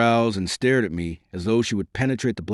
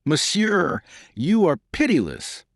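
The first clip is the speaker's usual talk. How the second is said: higher-pitched indignant protest; 9 semitones higher, slower than usual. A narrator's reading. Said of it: "protested in a tone of indignation".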